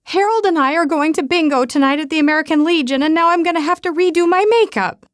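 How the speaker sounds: high, loud tearful complaint; a teary aunt fretting over her makeup; weepy aunt. A narrator's reading. Weeping aunt keeps complaining.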